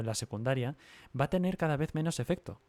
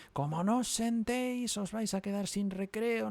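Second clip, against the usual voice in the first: much higher, shouted scolding threat; hammed-up classroom yell, pitched way up; mock teacher yell; 10 semitones higher than usual. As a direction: play the strict teacher barking at kids. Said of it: "les pegan el grito".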